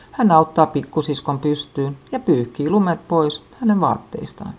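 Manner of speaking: usual speaking voice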